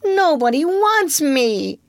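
put-on high, slow little-boy wail; nearly crying. A narrator's reading. Unwanted Michael complains to his mother, nearly crying. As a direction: whine it out on the brink of tears. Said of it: "Michael had nearly cried".

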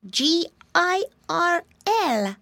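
high, slow spelling; little pig voice. Acted. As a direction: spell it out like a piglet.

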